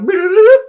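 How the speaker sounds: high, loud wordless trill; loud rolling sound effect; imitated climbing noise. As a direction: mimic a counter racing upward. Imitates the subscriber count climbing.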